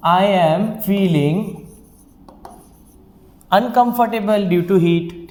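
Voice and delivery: slow sentence with long pauses; slow dictation. Shown as a demonstration. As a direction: build it up slowly for the class. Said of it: "थोड़ा सा हम उसमें कुछ और add कर देते हैं".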